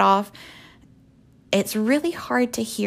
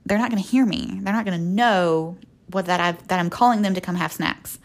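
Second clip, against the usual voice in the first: ordinary talk, nothing marked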